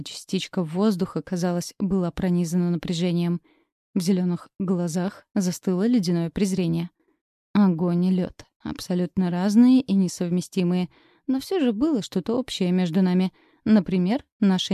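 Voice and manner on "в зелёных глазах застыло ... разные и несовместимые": usual speaking voice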